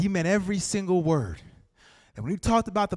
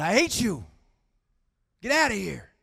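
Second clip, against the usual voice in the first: mildly acted angry outburst; retold rage; 6 semitones higher, 5 dB louder than usual. Storyteller voicing a hostile woman at the march, retold rage. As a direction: lift the voice briefly to imitate fury. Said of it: "came up to him in a fit of rage".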